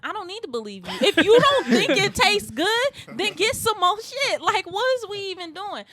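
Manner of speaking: high, loud, laughing disbelief; exasperated laughing rant. Real, not acted. To podcast friends, laughing loud outrage at her date. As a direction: vent it between laughs, voice up high. Indignant outburst while retelling date.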